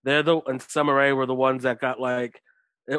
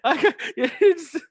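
talking through real laughter, pitched up; laughing; 5 semitones higher than usual. Real, not acted. Co-host explaining the wrestler's old indie name, laughing. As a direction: get the words out while laughing.

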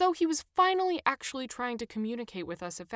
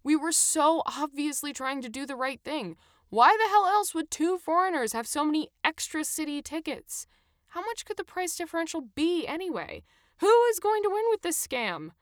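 higher, louder exasperated tirade; building indignation at the scam; fed-up questions that climb in pitch; frustrated rant; 8 semitones higher, 5 dB louder than usual. A narrator's reading. Adam keeps ranting about fine.

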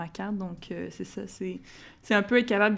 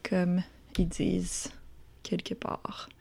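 whispered aside; 2 semitones lower, 7 dB quieter, slower than usual. Acted. Aside after teasing quote.

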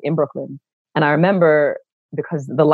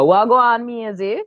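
higher, casual Jamaican patois; 8 semitones higher, slower than usual. Retold or imitated. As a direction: drop into Jamaican patois with a friend on the line. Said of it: "step right into the patois".

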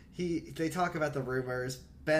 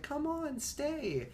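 high, soft, drawn-out coaxing; coaxing plea; 13 semitones higher, 4 dB quieter, much slower than usual. Retold or imitated. Adrian begs Ricky to stay, coaxing. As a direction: imitate a wheedling plea to stay.

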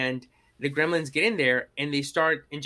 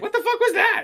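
very high, louder mock disbelief; shocked outburst; 7 dB louder than usual. Quoting a stunned fellow viewer after the scene, high shocked outburst. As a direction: shriek it in appalled astonishment.

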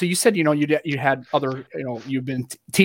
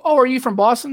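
surprised question; 9 semitones higher than usual. Retold or imitated. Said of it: "And then they go".

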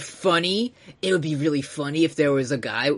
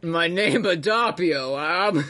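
slow, hammed-up Buenos Aires accent; mock Buenos Aires accent; 2 semitones higher, slower than usual. Friend echoing the Adapio bit, comic Buenos Aires accent. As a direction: draw it out in a fake Argentine accent. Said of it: "I'm from Buenos Aires".

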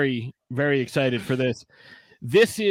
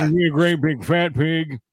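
Buffalo Bill voice; 4 dB louder than usual. Retold or imitated. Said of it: "You sound like Buffalo Bill".

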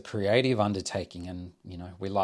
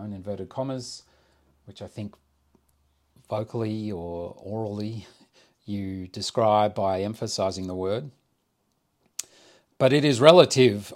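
usual speaking voice

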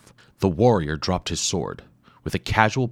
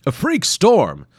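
much higher, louder, slow exclamation; surprised scientist; 11 semitones higher, 7 dB louder, slower than usual. Acted. Wimple arriving, exclaiming loudly. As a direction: cry out in wonder. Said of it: "came a voice".

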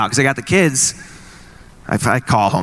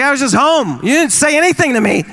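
exasperated; 11 semitones higher than usual. Retold or imitated. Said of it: "I was like".

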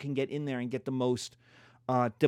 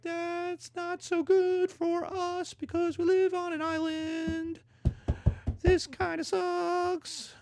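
long drawn-out high falsetto; mock British dismay; 14 semitones higher, slower than usual. British worrying about German ships, high falsetto mock dismay. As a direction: whine it slowly in a silly falsetto.

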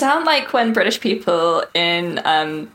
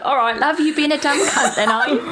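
mock Cockney; 6 semitones higher than usual. Friend mimics British cliché.

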